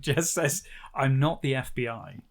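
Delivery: ordinary delivery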